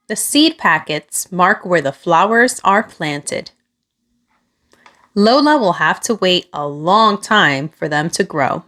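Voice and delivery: plain reading voice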